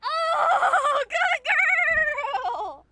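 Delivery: high, loud laughing praise for the dog; thrilled dog owner praising her pet; delighted praise. Real, not acted. Praising dog after it vocalizes.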